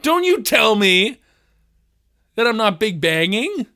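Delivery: high, hammed-up declaiming; mock outrage. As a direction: proclaim it loudly in overblown indignation.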